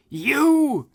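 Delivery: very high, loud berserk scream. Acted. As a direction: shriek it in rage at the king. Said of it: "she screamed".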